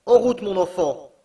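loud, high urging call; distant call. A narrator's reading. Uncle urges his nephew onward, loud high call. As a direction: push him on with your voice.

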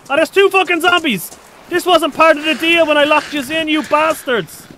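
outraged yell. Real, not acted. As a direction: curse the zombies in shock. Surprised by zombies in room.

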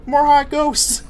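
high, loud cartoon cheer; Goofy. Streamer joking about the game's ghosts, Goofy impression. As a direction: crow it in a goofball cartoon voice. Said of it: "I gotta talk like Goofy".

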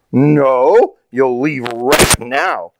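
loud, drawn-out angry order; demanding. A narrator's reading. Landowner refuses Papa a night's camping, demanding angrily. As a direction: order them out, stretching the words. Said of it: "demanded the man".

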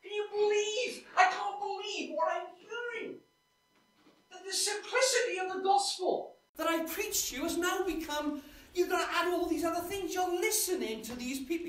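Paul erupting in disbelief at his letter; astonished outburst. Retold or imitated. Paul dictating to the Galatians, astonished and indignant. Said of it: "You can imagine walking back and forth".

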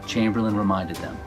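usual speaking voice

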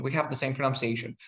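ordinary talk

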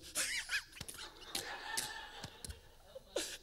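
high, wheezy mock laughing fit; imitated helpless laughter. Imitating Ryan in tears after the bad singing, helpless laughter. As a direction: act out someone helplessly cracking up. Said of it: "He goes".

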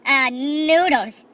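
very slow drawn-out noodles; slowly dodging the request; evasive answer. Retold or imitated. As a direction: stall with a dodge word. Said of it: "And I was like".

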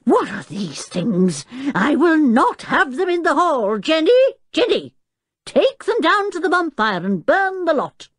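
angry bellow. A narrator's reading. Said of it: "the professor bellowed in anger".

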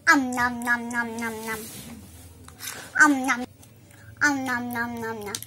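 playful pretend munching; nom nom chomping. Pretend eating toy food.

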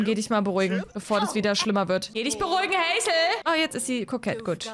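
ordinary delivery

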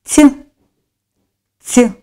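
Ц softened to ць, said alone and loud; Ц as a short, emphatic soft ць; soft consonant sound. Shown as a demonstration. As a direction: sound out a palatalized Ц twice, with a pause between. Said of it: "м'який".

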